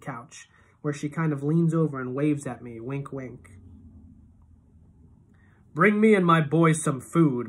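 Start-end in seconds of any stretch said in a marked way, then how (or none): 5.7-7.5 slow, loud order; commanding. A narrator's reading. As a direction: order it slowly and loudly. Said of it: "my father says".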